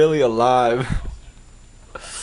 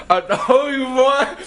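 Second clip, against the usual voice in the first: laughing; 12 semitones higher, slower than usual. Real, not acted. Teasing with a laugh, pitch raised.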